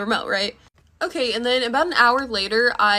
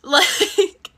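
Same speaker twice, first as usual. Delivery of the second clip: laughing; 7 semitones higher than usual. Real, not acted.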